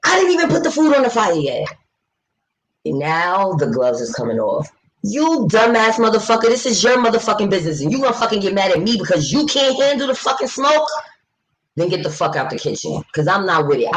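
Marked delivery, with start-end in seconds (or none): 0.0-1.7 imitating cook. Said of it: "He said". 5.0-13.0 higher, heated rant at the cook; heated retold rant at the rude restaurant owner; angry rant.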